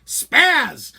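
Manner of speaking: high-pitched mock yell; retold yell. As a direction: yell out the nickname as the mom did. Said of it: "And she yells out".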